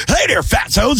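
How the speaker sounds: high-pitched, projected video intro; mock show-host greeting. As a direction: greet viewers in a hammed-up high voice. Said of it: "I'm speaking with my lower voice".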